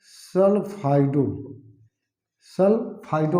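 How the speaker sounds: सल्फाइडो slow, in long separate syllables; drawn-out term. Shown as a demonstration. Teacher repeating the ligand name for students, slowly drawn out. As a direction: stretch सल्फाइडो part by part. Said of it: "क्या हो जाएगा?".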